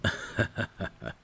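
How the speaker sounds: light unvoiced chuckle. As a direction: chuckle gently, amused. Amused by lady's modesty.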